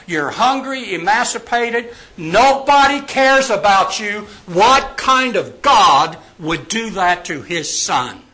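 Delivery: loud, high, pressing accusation; taunting tempter. Acted. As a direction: hammer it home as a goading challenge. Preacher voicing Satan's taunting temptation.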